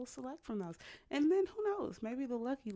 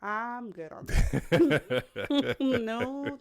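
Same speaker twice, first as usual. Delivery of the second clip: louder, laughing slowly; 3 semitones higher, 9 dB louder, much slower than usual. Real, not acted. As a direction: laugh off the idea. Laughing at lick suggestion.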